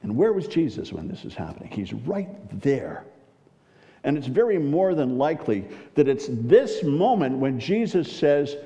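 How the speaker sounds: ordinary delivery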